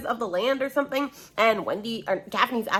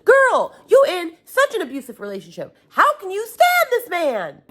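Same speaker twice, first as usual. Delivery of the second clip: high, loud disbelieving rant; exasperated outburst; 10 semitones higher, 4 dB louder than usual. Real, not acted. Reader scolds the heroine, exasperated outburst. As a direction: cry out in exasperation at her.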